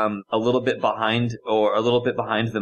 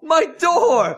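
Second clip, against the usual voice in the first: mock anguished cry. Player wails over wrecked car door.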